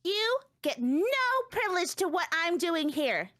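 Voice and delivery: put-on high, haughty little-girl voice; sassy child character. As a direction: play her as a smug, secretive brat.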